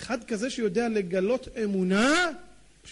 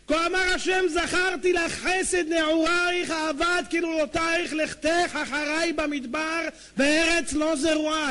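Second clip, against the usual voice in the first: slow, raised prophetic chant of the verse; slow and solemn, higher, in the manner of a biblical proclamation; solemn recitation; 4 semitones higher, slower than usual. Acted.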